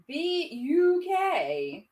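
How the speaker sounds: "B U K" slow and high, letter by letter; affected spelling out. Acted. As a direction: draw out "B U K" grandly, up high. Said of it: "she pronounced it".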